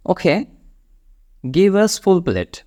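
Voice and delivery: each word drawn out slowly for learners; slow, clear demo of the order; slow model sentence. Shown as a demonstration. Teacher to students, ordering food slowly and clearly. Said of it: "এইভাবে বলতে পারেন".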